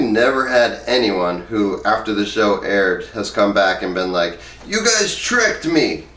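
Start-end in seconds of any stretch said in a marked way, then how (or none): 4.7-6.1 mock accusing guest. Quoted guest complaining on show.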